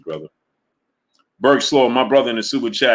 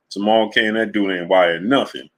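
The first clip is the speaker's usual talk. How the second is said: normal talking voice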